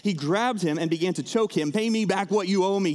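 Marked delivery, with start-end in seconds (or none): 1.7-2.9 raised, louder mock demand from a creditor; a touch of a creditor grabbing a debtor. Servant choking his fellow servant, mild mock demand. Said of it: "he demanded".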